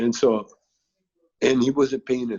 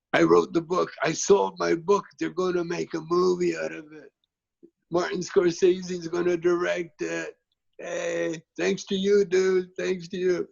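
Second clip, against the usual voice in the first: higher-pitched retold announcement, held up throughout; excited friend calling up; 7 semitones higher than usual. Jordan tells his friend at the window about the movie, excited calling voice. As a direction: call the good news up to a window, high and sustained.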